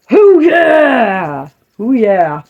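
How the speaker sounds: cheering whoop. Pretend. Cheering on followers who tag the page, loud whoop. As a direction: whoop like a hype crowd. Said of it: "we can be right there going".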